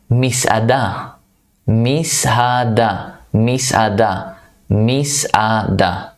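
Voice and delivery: Misada stretched out slowly, syllable by syllable; slow repetition. Shown as a demonstration. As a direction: drag out Misada for the students. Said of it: "I say it a few times".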